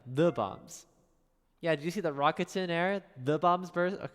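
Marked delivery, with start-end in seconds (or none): 0.1-0.9 disgusted quote. Shown as a demonstration. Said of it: "I hate that line".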